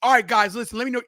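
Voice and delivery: normal talking voice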